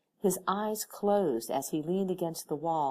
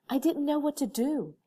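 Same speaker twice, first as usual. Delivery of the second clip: higher, nervous admission; a touch of panic, pitched up; shaky voice; 11 semitones higher, 4 dB louder than usual. A narrator's reading. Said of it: "in a shaky voice".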